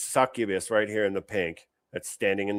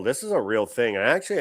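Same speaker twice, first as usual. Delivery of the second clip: usual speaking voice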